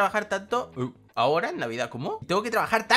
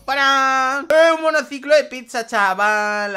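louder, higher burst of delight; excited shout; 5 semitones higher, 8 dB louder, slower than usual. Real, not acted. Player unwrapping a pizza unicycle, excited shout. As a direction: yell it out thrilled by the gift. Opening a giant gift.

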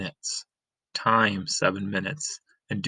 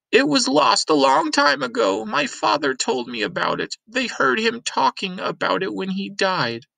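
much higher, distracted old woman; old Granny; 12 semitones higher, 3 dB louder than usual. Granny rummaging and talking to Lena, higher old woman's voice. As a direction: mutter excitedly while rummaging.

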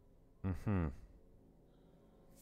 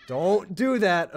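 slow, loud, high plea; drawn-out frightened begging; scared pleading; 11 semitones higher, 10 dB louder, much slower than usual. Real, not acted.

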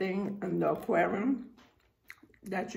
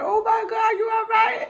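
much higher, louder, yelling through laughter; laughing shout; 8 dB louder than usual. Retold or imitated. Behind them on the ride, shouting to mom through laughter. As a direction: call out to mom while cracking up. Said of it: "I'm laughing because it's so funny".

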